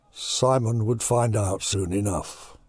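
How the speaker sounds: ordinary delivery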